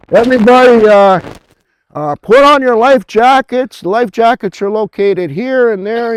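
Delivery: ship's loudspeaker voice, high and loud; PA announcement. Retold or imitated. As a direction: boom it out like a cruise PA telling everyone to grab life jackets. Ship PA drill announcement.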